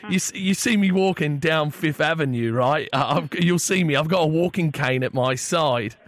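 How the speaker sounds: chatty fake English caller; poor English accent. Prank caller slips song lyrics to cafe, poor English accent. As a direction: ramble on in a badly faked English accent. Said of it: "disregard the piss poor English accent".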